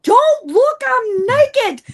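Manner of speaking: high, loud alarmed cry; panicked yell. Retold or imitated. Caught undressed by spirits, panicked yell. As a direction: shout them away in a panic. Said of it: "I'm like".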